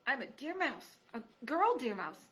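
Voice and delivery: small mouse answering carefully; timid mouse. Acted. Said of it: "mouse answers timidly".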